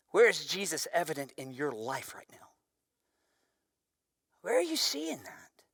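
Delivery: hushed whisper to the congregation; breathy-free secret whisper, voice dropped. Real, not acted. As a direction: lean in and whisper it. Pastor asks reflective question softly.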